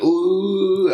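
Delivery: high, loud, drawn-out "ooh"; mock crowd "ooh". Club crowd reacting to a heckle, mock jeering ooh. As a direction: imitate a crowd's rising reaction.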